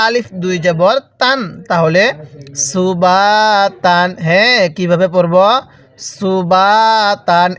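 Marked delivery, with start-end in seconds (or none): 2.7-4.1 slow "সুবাতান" with a long held বা; drawn-out vowel. Shown as a demonstration. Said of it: "এরকমভাবে শিখব". 6.1-7.5 slow "সুবাতান" with a long held বা; drawn-out vowel. Shown as a demonstration. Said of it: "এরকমভাবে শিখব".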